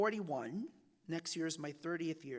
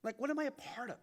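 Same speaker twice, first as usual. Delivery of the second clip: higher, searching question to himself; asking himself what the taxes pay for, higher and searching; 8 semitones higher than usual. Retold or imitated. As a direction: ask it up high, wondering. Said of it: "then I ask myself".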